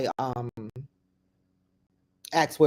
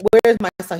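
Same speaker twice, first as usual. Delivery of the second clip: louder, higher cry of where is my son; desperate demand; 6 semitones higher, 8 dB louder, faster than usual. Retold or imitated. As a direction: call out in mounting panic. Mother demanding at crime scene.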